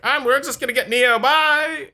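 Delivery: high, light mocking robot voice. Friends mocking the movie robots, high and breezy. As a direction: ham up a clueless robot crew.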